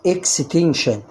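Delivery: اكستنشن said ekstenshen with sh; sh in extension. Shown as a demonstration. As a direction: say اكستنشن with sh near the end. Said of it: "هتنطق برضو شين".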